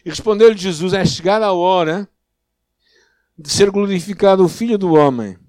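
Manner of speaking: projected, loud Bible reading with firm emphasis; scripture reading. Real, not acted. Preacher reading Jesus' answer to the congregation, projected scripture reading. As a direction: read the Gospel out with solemn force.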